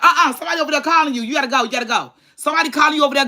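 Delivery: high, urgent mock scolding; frustrated. Sister shooing dad away from mama, frustrated. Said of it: "my sister got so frustrated".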